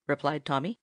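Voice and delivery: ordinary delivery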